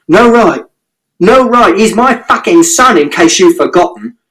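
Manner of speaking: high, hammering yell of no right; outraged shout. A narrator's reading. Father shouting at mother about his son. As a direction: fling her words back at her. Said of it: "And Father shouted".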